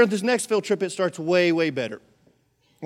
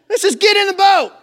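high, very loud command; shouted order; 13 semitones higher, 11 dB louder than usual. Acted. Jesus ordering reluctant disciples into the boat, shouted command. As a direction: yell it to end the argument.

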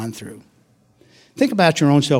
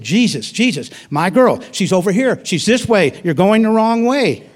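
desperate pleading; 7 semitones higher than usual. Retold or imitated. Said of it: "He probably said".

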